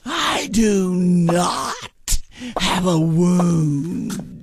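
"I do not have a wound" very slowly, drawing out "do not"; emphatic denial. Acted. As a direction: stretch "not" and "wound" in slow, strained insistence. Old woman insists on her denial.

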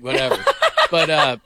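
ordinary talk, nothing marked

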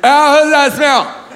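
high, drawn-out groggy cry; half-asleep blurt. Acted. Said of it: "when you blurt shit out in, like, a half sleep".

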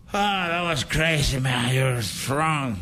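ordinary talk

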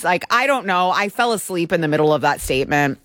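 ordinary talk, nothing marked

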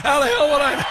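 delighted echo. Retold or imitated. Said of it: "I like steak".

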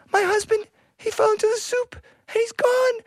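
hammed-up high-pitched crying wife; wailing. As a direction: wail it comically, voice way up high. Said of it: "she started crying".

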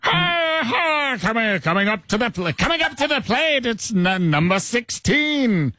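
put-on high, old-timey announcer with an Irish lilt; Irish-tinged stadium announcer. Host asked to introduce the Lug Nuts, high Irish-tinged announcer. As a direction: call the batter up to the plate, Irish-flavoured. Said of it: "introduce the Lansing Lug Nuts right now".

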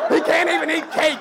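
can't punched hard. Acted. Dad yells can't even eat cake, loud. Repeated line, first take.

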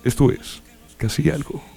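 lightly voiced radio host intro; radio announcer voice. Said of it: "Con voz de locutor de radio".

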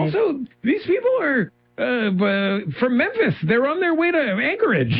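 high, exasperated comic protest; hammed-up incredulous objection; mock indignation. Continuing mock protest about LAX.

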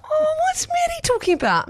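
high, puzzled mock question; sudden high confused wonder; surprised. Mock surprised inner thought.

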